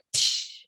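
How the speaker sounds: a soft unvoiced crash noise, breathed out; imitated glass shattering. Mother retells hearing the ornament smash, soft breathy crash noise. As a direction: mimic the ornament bursting with a quick psh. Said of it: "I hear".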